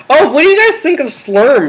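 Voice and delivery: high cartoon voice; goofy high-pitched question; silly high voice. Keeps silly voice.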